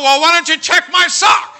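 loud, high demanding yell; exasperated shout. Retold or imitated. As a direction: demand it at the doctor. Demanding his lost toe.

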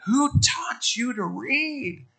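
very high, drawn-out wonder; amazed question. Retold or imitated. Preacher retelling his question to a little girl learning to read, amazed wonder. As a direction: coo it slowly up high, as to a small child.

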